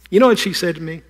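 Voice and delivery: usual speaking voice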